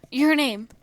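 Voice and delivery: loud sharp shout. A narrator's reading. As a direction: shout the name in alarm. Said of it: "Donnie shouted".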